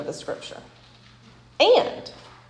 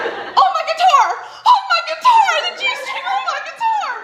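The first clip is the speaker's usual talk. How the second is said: mock panic, pitched way up high; voice up octaves. Husband realizing his slip before the congregation, mock high-pitched panic. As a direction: squeal it like a flustered husband who just caught his own slip. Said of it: "his voice goes up, I don't know how many octaves".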